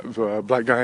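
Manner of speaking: ordinary delivery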